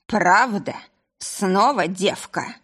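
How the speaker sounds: slow slurred disbelief; thick-tongued drunken grumble. Acted. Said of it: "промычал, еле ворочая языком".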